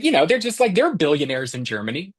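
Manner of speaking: ordinary delivery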